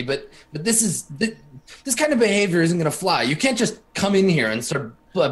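flustered, stammering indignation with jumps in pitch; blindsided sputtering. Acted. Professor to his blackmailer, sputtering protest. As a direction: sputter and restart, caught off guard. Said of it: "sputters a little, and he's just totally blindsided".

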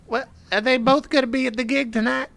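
high, puzzled put-on voice; high-pitched Higgins. Player as Higgins questioning his rival about the gig, high-pitched. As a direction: ask it up high, caught off guard. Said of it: "High pitched".

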